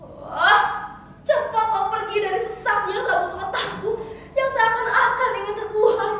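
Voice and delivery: loud, high anguished rant; theatrical cry of revolt; defiant outburst. Acted. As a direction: shout it as a final refusal. Refusing to be a slave.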